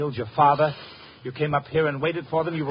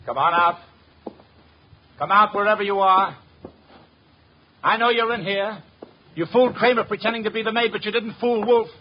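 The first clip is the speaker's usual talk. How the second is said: raised voice, calling into the empty flat; 6 semitones higher, 4 dB louder than usual. Acted. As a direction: call out to someone hiding, firm and loud. Archie calls out to hidden intruder.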